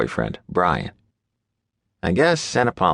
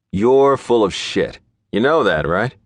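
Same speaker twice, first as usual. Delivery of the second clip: slow, louder irritated jab; 5 semitones higher, 4 dB louder than usual. A narrator's reading. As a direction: call him out, annoyed. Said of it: "An irritated huff".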